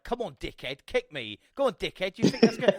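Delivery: hammed-up cockney yell; mock cockney shouting. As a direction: goad him like a London geezer. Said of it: "He's shouting like".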